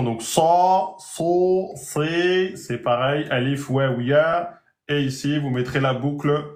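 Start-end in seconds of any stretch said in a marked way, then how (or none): 0.0-2.6 "sa, so, si" long and backed; prolonged emphatic demo; lengthened emphatic vowels. Shown as a demonstration. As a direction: stretch each emphatic vowel. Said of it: "avec la prolongation".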